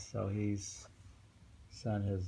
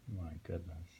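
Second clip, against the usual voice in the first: usual speaking voice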